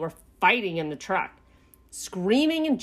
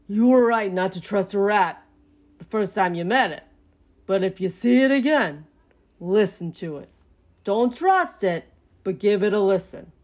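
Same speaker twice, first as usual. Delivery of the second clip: firm, slightly raised dad voice; advising father; 4 dB louder than usual. A narrator's reading. Dad advises Pinky about the rat, firm. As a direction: counsel your son firmly.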